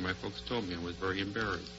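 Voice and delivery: ordinary talk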